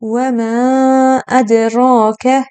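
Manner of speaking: ادراک with a long stretched alif, very slow; very slow chanted و ما ادراک; drawn madd. Shown as a demonstration. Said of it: "دو برابر حرکت فتحه".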